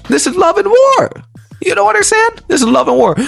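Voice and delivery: mock high repeat. Preacher mocking the men who miss it, high squeak. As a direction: toss the line back up high, teasing. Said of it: "mocking repeat of his line".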